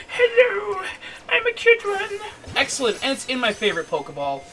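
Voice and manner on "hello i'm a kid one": very high, tiny squeaky hello; cute creature voice. Pretend. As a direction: speak as a cute little creature.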